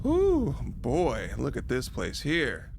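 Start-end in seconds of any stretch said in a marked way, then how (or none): 0.0-0.5 high, loud nervous whew; startled whew. Real, not acted. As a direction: let out a spooked exhale. Reacting to dark basement footage.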